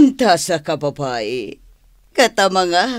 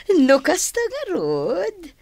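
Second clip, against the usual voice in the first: coy wheedling; 12 semitones higher than usual. Acted. Wife wheedles for money.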